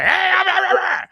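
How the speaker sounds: high yelping imitation; mock call. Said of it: "whatever the hell that is".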